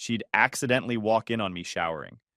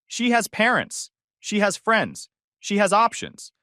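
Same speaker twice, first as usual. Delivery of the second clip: raised high call across the room; calling over; 9 semitones higher, 4 dB louder, slower than usual. Retold or imitated. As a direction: call it out from the kitchen. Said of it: "called over".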